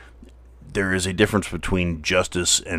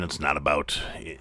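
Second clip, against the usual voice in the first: ordinary talk, nothing marked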